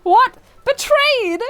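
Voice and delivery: very high, loud disbelief; outraged at the betrayal; shocked outburst. Acted. Dog switching sides, shocked outburst. Dog abandons his master.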